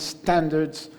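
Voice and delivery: normal talking voice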